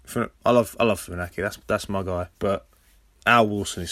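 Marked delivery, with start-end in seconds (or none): none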